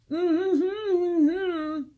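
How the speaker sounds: high, loud closed-mouth hum; taped-mouth insult; muffled angry hum. Acted. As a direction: hum a rude retort through tape.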